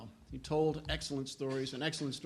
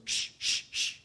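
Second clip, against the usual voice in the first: sharper, more insistent whispered shh; emphatic shushing; slower than usual. Retold or imitated. Annoyed readers shushing the laughing scholar again, emphatic whispered shh. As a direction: shush him harder this time. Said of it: "more emphatically this time goes".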